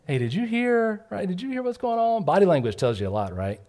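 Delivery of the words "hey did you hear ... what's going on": eager gossip. Retold or imitated. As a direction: dangle the juicy news. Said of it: "they're like".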